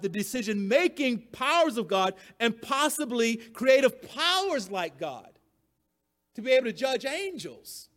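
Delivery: usual speaking voice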